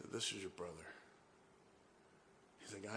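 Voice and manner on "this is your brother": very soft, low admission; subdued. Retold or imitated. Caller revealing he is the brother, subdued and quiet.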